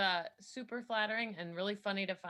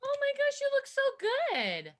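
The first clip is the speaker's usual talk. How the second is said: much higher, squealing praise; excited; 5 dB louder than usual. Real, not acted. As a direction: gush over how good he looks. Admiring partner's shirt.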